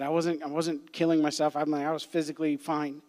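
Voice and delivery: normal talking voice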